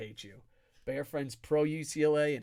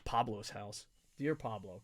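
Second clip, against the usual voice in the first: normal talking voice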